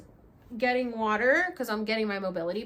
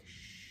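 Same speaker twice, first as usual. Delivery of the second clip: hush; 19 dB quieter than usual. Retold or imitated. Guide hushing her.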